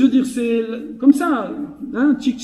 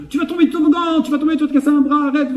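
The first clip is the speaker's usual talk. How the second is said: high, rushed warning cry; high-pitched nagging alarm, words tumbling out; fretting granny impression; 7 semitones higher, much faster than usual. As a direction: imitate a panicky grandmother at the playground. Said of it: "les mamies qui disent".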